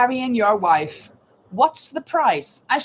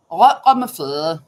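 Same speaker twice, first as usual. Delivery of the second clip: imitating a throaty Danish accent, louder; 5 semitones lower, 6 dB louder than usual. Shown as a demonstration. Host mimics how Danes talk, in a throaty Danish accent. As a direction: say it like a Dane with a potato in the throat. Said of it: "They talk like this".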